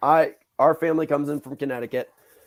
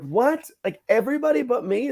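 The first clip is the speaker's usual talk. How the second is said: high-pitched incredulous protest; acted left-out kid, voice shooting way up; indignant disbelief; 11 semitones higher than usual. Retold child protesting being left out.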